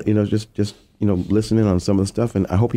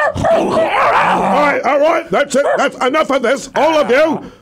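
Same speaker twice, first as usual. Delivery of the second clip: shouting; slower than usual. Acted. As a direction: yell to silence the room. Yelling at noisy people in there.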